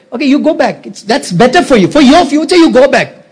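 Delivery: high-pitched, insistent persuasion; acted high, pressing plea; earnest urging. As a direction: urge them away for their own good, pitched well up. Acts Naomi urging Ruth to leave.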